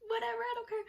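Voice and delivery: high, whiny brush-off; hammed-up weepy defiance; mock tearful retort. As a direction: wail it defiantly through pretend tears. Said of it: "And I'm like".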